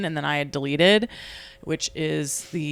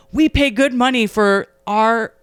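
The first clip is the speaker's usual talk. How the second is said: louder, higher indignant dad; indignant rant; 5 semitones higher, 9 dB louder than usual. Retold or imitated. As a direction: rant about paying for trash. Dad ranting about dumpsters.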